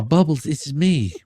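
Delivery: ordinary talk, nothing marked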